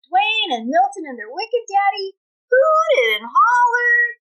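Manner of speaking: lively read-aloud with a country twang; storybook reading. A narrator's reading. Teacher reading a cowboy tale to her class, lively twang.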